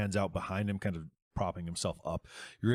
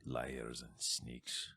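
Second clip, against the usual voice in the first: much lower, quieter and slow; low slow contempt; 7 semitones lower, 10 dB quieter, much slower than usual. Acted. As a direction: mutter it with disgust. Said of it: "kicking dirt over body".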